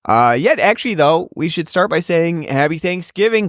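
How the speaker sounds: normal talking voice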